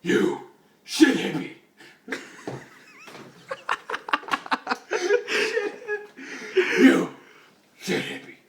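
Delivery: laughing insult. Acted. Batman insulting the hippie, laughing.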